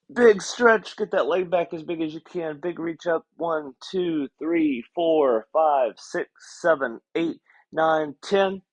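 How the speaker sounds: slow, steady exercise count; counting a stretch. Acted. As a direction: count them through the hold.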